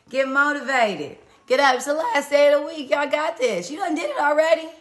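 hype. Real, not acted. Motivating viewers to get up.

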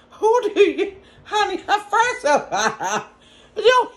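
high, laughing mock challenge; retelling the comeback while cracking up. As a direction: laugh through the retort. Retold comeback, laughing through it.

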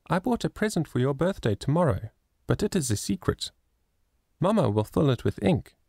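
put-on high, eager childlike voice; a sweet little girl coaxing her mother; little girl Lily. A narrator's reading. Little Lily pleading with mamma to buy the flowers, high and childlike.

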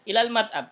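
a teacher's emphatic "ilal mat'am" answer; firm answer. Shown as a demonstration. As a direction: state "ilal mat'am" with a strong drop at the end.

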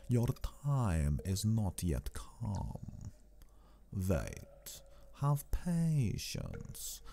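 put-on very slow, hushed accented Count, trailing into whisper; Count Dracula. A narrator's reading. Count whispering outside the door to the waiting women, slow and hushed. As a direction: hold them back with drawn-out, swooping, softly menacing words. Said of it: "I heard the voice of the Count".

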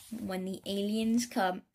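ordinary delivery, nothing marked

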